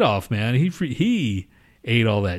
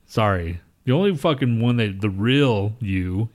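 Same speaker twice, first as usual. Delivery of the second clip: ordinary talk, nothing marked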